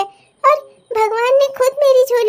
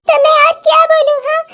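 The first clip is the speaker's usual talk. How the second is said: higher, loud and bitter; despairing shout; 4 semitones higher, 6 dB louder than usual. Acted. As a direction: throw the question back at her. Mother shouting back in despair. (हताश होकर चिल्लाती माँ)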